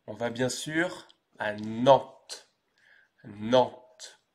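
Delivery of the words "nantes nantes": NANTES said alone as French "nant"; pronunciation demo. Shown as a demonstration. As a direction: NANTES with nasal vowel and sounded t, silent s. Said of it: "On prononce Nantes".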